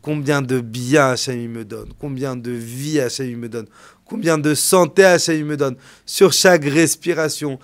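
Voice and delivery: high, loud, impassioned sermon climb; preacher rising to a loud impassioned climax; fiery preaching. Acted. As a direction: build the sermon to a loud peak. Preaching at full force.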